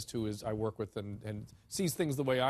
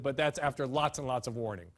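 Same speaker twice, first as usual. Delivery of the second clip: ordinary talk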